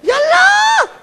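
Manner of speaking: high, loud shouted gran's Farsi rant; a granny yelling in Farsi, way up high. Retold or imitated. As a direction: shriek it like an excited old gran. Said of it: "I learnt it off me gran".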